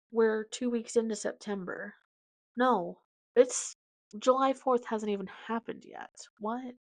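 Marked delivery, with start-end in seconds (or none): none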